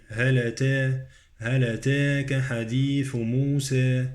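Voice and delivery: "أتاك" with vowel tilted towards "e"; taqlil. Shown as a demonstration. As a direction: recite "موسى" ending near "-se". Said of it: "عندي تقليل".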